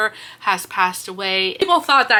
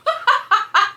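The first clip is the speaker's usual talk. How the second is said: laughing at own flub; a high giggling burst; amused laugh after a tongue slip; high burst of laughter; 13 semitones higher than usual. Real, not acted. Laughs at botched names.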